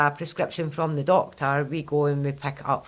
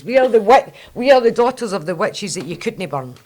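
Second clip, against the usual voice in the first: defiant proclamation; 5 semitones higher, 9 dB louder than usual. Acted. Proud defiant witch declaration.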